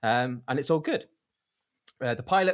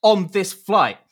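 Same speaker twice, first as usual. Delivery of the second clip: higher, louder stern rule-telling; stern scolding; 10 semitones higher, 12 dB louder than usual. Retold or imitated. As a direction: scold her by the rules. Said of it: "he's like".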